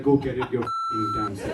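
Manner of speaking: high, laughing mock snap; hammed-up high scolding wife. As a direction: play the fed-up wife, squeaky and amused. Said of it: "she was like".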